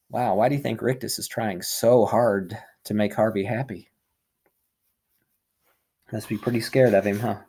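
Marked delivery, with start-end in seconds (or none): none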